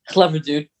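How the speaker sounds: normal talking voice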